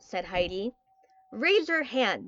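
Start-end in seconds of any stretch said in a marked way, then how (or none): none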